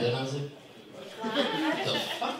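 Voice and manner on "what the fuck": high, slow stunned disbelief. Retold or imitated. Teller reacting to customer's nonsense, high slow disbelief. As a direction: drag it out in disbelief, pitched way up.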